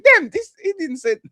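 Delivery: high, startled take on the video; retold disbelief. Retelling his shock at the video, high startled disbelief. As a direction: act out the shock of seeing it.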